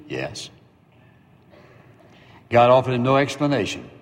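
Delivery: ordinary delivery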